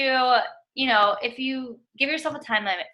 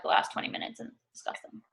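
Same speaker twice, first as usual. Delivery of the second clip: muttered disgust; 9 dB quieter than usual. Real, not acted. Complaining about her vegetables.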